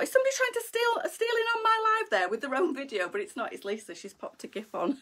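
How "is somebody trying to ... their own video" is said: pitched right up, rushing the worry out; panicked that someone is stealing the stream; alarmed. Real, not acted. Host fearing a stranger hijacked her live video, alarmed high.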